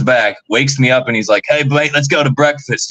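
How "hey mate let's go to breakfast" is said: imitated Australian, pitched up; Australian accent. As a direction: play the Australian mate, slightly hammed up. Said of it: "he's got this Australian accent".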